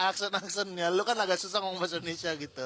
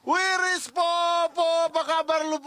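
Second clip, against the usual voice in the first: loud, high, happy greeting; excited shout; 12 semitones higher, 5 dB louder than usual. Pretend. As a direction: call out to an old friend. Acted greeting of returning friend. (teriak senang menyapa teman lama)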